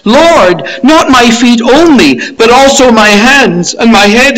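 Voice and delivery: high, loud, impulsive plea; over-eager Peter. Acted. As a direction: beg eagerly, swinging to the other extreme. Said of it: "He's either one extreme or the other".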